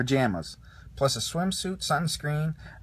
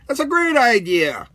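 much higher, louder excited cry; Buzz cries out; 9 semitones higher, 9 dB louder than usual. A narrator's reading. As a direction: burst out with heroic delight.

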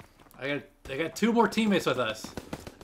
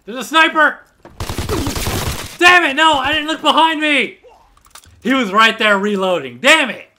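high, loud angry shouting; furious yell; 8 semitones higher, 9 dB louder than usual. Real, not acted. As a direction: scream it at the screen. Sniped, furious.

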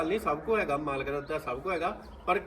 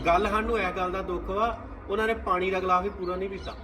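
ordinary talk

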